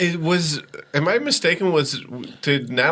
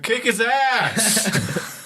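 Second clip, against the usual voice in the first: high, drawn-out jeer; mock heckling yell; 12 semitones higher, much slower than usual. Moviegoer fed up with the whispery scene, heckling yell. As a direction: heckle it slowly, pitched way up.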